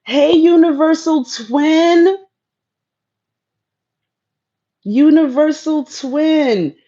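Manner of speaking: high, loud, drawn-out greeting; calling out. Real, not acted. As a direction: call out slowly to a friend. Greeting a viewer by name.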